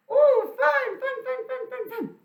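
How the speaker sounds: very high, loud squealing chant of fun; excited squeal. Acted. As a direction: squeal it out like a thrilled little hen. Puppet hen squeals with delight.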